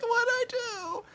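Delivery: very high, drawn-out whine; mock panicked Hiroko. Imitating a girl calling back helpless, high drawn-out whine.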